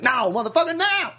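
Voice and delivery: high, hammed-up outburst; mock angry refusal. Refusing to take the car, mock angry.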